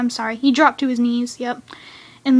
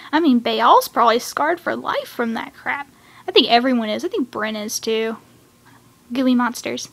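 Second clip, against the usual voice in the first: usual speaking voice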